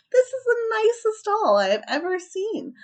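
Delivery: high excited customer; delighted man. Acted. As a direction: gush over the perfect doll. Man admires doll.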